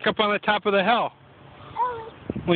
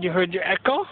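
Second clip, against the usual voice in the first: much higher, delighted question; high playful question; 9 semitones higher than usual. Real, not acted. Repeats question to child playfully.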